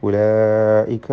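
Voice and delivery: ഉലൈക with a long held aa; obligatory madd. Shown as a demonstration. Teacher reminds students lengthening is required, ഉലൈക held. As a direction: draw out the middle vowel of ഉലൈക. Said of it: "മദ് മുത്തസിൽ വാജിബ്".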